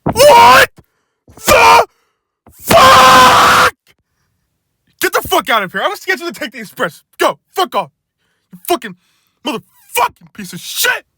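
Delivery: loud, high outraged scream; furious shout. Acted. Workman screams at James to get off the train. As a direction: explode at the intruder.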